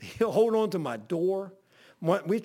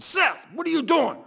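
loud, high exasperated demand; mock mad yell; 8 semitones higher, 5 dB louder than usual. Preacher confronts Seth over clothes in the parking lot, mad. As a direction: retell an angry holler at a troublesome man. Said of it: "I got mad".

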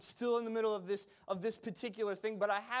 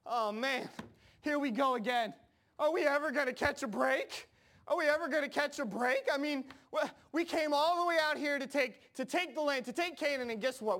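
high, loud-ish whine; hammed-up, exasperated bellyaching; mock crying complaint; 4 semitones higher, 3 dB louder than usual. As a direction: imitate a crowd wailing at its bad luck. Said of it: "Israel starts crying. They're like".

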